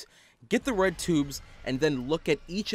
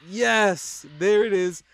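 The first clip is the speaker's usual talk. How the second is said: excited; 4 semitones higher, 5 dB louder, much slower than usual. Real, not acted. Jump scare finally triggers.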